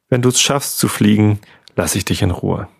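ordinary delivery, nothing marked

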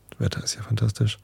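hushed unvoiced whisper. Real, not acted. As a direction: whisper it gently, sign-off. Signing off, whispering to listeners.

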